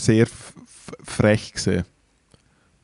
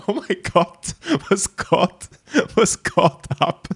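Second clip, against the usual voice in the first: laughing disbelief; 8 semitones higher, much slower than usual. Retold or imitated. Shocked reaction, retold.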